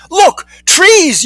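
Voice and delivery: very high, thrilled cry; excited yell. A narrator's reading. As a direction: call out an amazing sighting.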